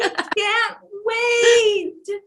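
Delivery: high, slow, excited cry; eager shout of joy; thrilled. Real, not acted. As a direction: gush with real excitement. Host thrilled about next book.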